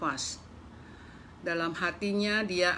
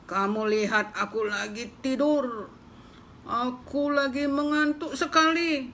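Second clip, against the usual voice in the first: grumpy pig; 6 semitones higher than usual. Acted. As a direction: brush the mouse off like a lazy pig. Pig lectures the mouse.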